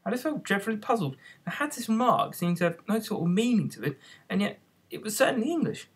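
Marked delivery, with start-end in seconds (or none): none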